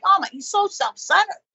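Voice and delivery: very high, put-upon complaint; exasperated wife. Acted. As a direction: vent about him in mock outrage. Acts exasperated wife in therapy.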